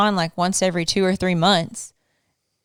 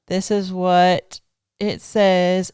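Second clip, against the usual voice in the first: "This is what it says" drawn out slow, each word weighted; slow it right down, like unveiling evidence; slow dramatic reveal; much slower than usual. Shown as a demonstration. Wife reading out her husband's post, slow and dramatic.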